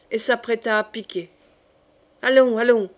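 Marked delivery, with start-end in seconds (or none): 2.2-2.9 loud, brisk urging. A narrator's reading. Coconas, ready to spur off, urges la Mole loudly. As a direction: urge a friend to get moving.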